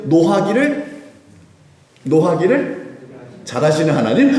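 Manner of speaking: deliberate wrong answer. Pretend. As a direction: play the joke answer to draw a laugh. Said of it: "아니, 아니에요". (일부러 틀린 답을 장난스럽게)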